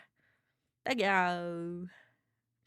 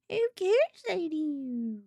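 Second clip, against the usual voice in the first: higher and slow, savoring each word; drawn-out eager reply; 8 semitones higher, much slower than usual. Acted. Streamer answers chat's offer, slow and eager. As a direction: stretch it out like a greedy kid.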